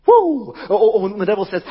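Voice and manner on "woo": a high, loud celebratory whoop; whoop of joy. Real, not acted. Preacher cheering after silencing the devil, loud whoop. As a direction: cheer like the devil just lost.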